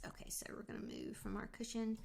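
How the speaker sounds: soft unvoiced whisper. Real, not acted. Son asleep, quiet home.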